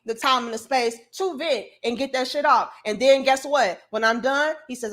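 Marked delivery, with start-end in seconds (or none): none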